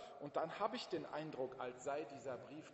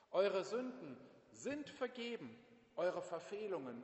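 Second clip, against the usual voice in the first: ordinary talk, nothing marked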